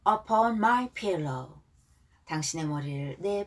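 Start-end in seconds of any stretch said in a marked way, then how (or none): 0.1-1.6 slow English phrases for repeating; careful, unhurried teaching read; pronunciation model. Shown as a demonstration. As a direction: draw each word out for learners to follow. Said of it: "발음 다시 한번 절 보고 따라하겠습니다".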